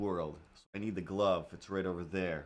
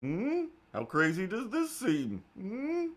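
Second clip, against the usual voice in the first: high, far-off put-on voice; sly wheedling with higher pitch; mock suspicious asking; 9 semitones higher, slower than usual. Asking friends about the names, in a sly put-on voice. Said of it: "was like".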